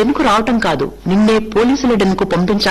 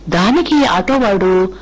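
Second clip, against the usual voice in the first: ordinary delivery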